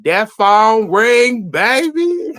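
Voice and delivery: put-on slow sing-song tease, baby climbing high and loud; teasing drawl. Host teasing the couple as the phone rings, slow sing-song.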